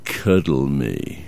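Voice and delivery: drawn-out sultry purr; sexy voice. Pretend. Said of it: "so in that sexy voice".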